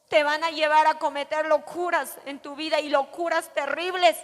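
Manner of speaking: usual speaking voice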